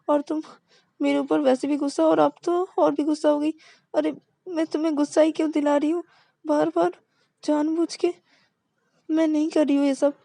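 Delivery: high, rushed, begging confession; desperate pleading. Acted. Partner desperately apologising and proposing to her girlfriend. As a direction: pour it out high and fast.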